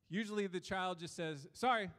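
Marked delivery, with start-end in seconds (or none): 1.5-1.9 quick, louder, higher toss-off sorry; curt, unfeeling apology. Retold or imitated. Child made to apologize to a sibling, quick and unfeeling. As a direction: imitate a child apologizing without meaning it. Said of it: "as quick as they can, with no feeling in it".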